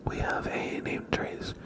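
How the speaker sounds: ASMR whisper. Real, not acted. ASMR whispered queue and name traces.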